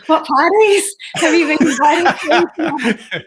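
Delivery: a cheeky retold question, laughing and drawn out; laughing mock ask. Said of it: "at the end of each day and say".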